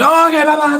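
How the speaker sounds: high, loud sing-song farewell; breezy goodbye. Retold or imitated. The lady dismissing him as her friends arrive, high and loud. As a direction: brush him off brightly and leave.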